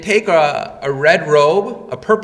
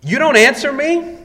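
much higher, louder furious demand; infuriated; 8 semitones higher, 6 dB louder than usual. Retold or imitated. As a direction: rage at the silent prisoner.

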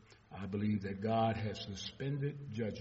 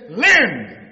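booming; 9 semitones higher, 20 dB louder than usual. Acted. Preacher booming a Bible word.

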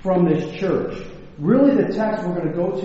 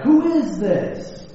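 higher, drawn-out echo of a call; mock yell back; 5 semitones higher, slower than usual. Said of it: "And the voice yelled back".

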